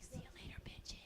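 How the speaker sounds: whispered, breathless see-ya; sly whispered goodbye. Shown as a demonstration. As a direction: hush it so nobody in class hears. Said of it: "I don't say it that loud because I respect the space".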